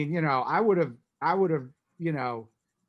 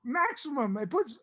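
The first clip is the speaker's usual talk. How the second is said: excited pitch; 13 semitones higher than usual. Retold or imitated. Pitching the move to John.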